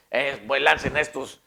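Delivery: loud, scornful command to throw them in; contemptuous shouted sentence; angry royal order. Retold or imitated. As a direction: bark it like a furious king. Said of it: "ya no les dijo peleles".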